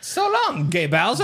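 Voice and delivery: high squeaky Mario voice; excited plumber cry; Mario impression. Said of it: "he's saying King Bowser".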